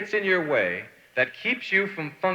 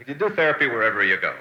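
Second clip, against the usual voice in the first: ordinary talk, nothing marked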